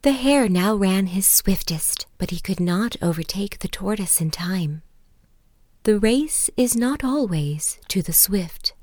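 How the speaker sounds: lively read-aloud fable; story narration. A narrator's reading. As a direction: tell it like a bedtime fable.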